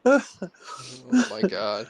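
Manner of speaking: very slow, stretched out; drawn-out groan. Real, not acted. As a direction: drag every word out in dismay. Drawn-out mock despair.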